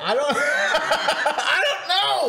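high, drawn out through real laughter; helpless giggly reply; laughing. Real, not acted. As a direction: answer while cracking up. Laughing at the haircut question.

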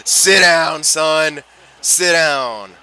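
loud, drawn-out gloating yell; taunting shout. Real, not acted. Gamer to the fighter he knocked down, taunting shout. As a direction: bellow it at the beaten opponent.

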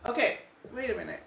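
laughing as she calls him back; a real laugh at her husband leaving. Real, not acted. Painter laughing to her husband who walks off, laughing.

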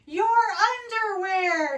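high, loud and drawn out; long, loud storybook exclamation; mother's amused holler. A narrator's reading. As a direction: call it out slowly, teasing. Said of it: "his mother laughed".

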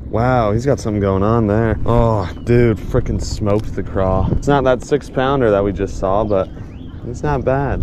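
ordinary talk, nothing marked

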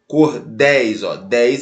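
"Cordéis" split, its ending repeated alone as "dez"; stress on last syllable. Shown as a demonstration. Teacher gives an example, final syllable of "Cordéis" singled out. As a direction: lean on the end of "Cordéis", then isolate it. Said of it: "é a última sílaba".

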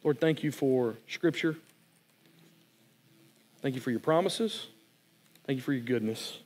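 closing prayer. Real, not acted. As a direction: pray it gently over the congregation.

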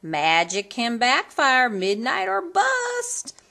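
high, slow, eager promise; Cindy promising the godmother; promised. A narrator's reading. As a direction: vow it brightly. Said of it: "Cindy promised".

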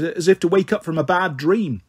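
ordinary delivery, nothing marked

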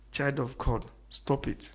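dropping to a whisper on stop it; hushed plea. Real, not acted. Preacher quietly pleading with the child of God, near whisper. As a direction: lean in and plead softly. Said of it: "quiet then unvoiced".

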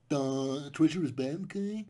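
dopey voice, drawn-out duh, rising okay; dumb moralizing voice. Acted. Mocking the movie's intelligent message, dopey voice. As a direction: sound like a dim know-it-all.

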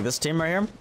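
ordinary talk, nothing marked

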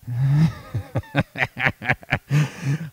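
a real warm chuckle; laugh. Real, not acted. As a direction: laugh at your own joke. Preacher laughs at own remark.

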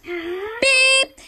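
very high beep; intercom beep. Pretend. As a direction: chirp like a loudspeaker.

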